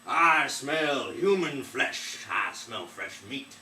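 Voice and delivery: slow, loud giant's roar; a big giant bellowing as he comes home; booming giant. Acted. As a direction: sniff out the meat, booming and slow. Giant arrives home sniffing.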